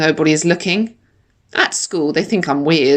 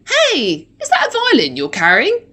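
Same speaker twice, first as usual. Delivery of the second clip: curious passer-by; 9 semitones higher than usual. Acted. Imagined passer-by asks about violin.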